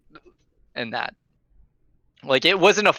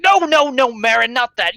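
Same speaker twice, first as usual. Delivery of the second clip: loud, high scolding cut-off; hushing drunk; 10 semitones higher, 6 dB louder than usual. Acted. Drunk leader cuts off Marin, loud and high. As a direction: shut the friend up fast.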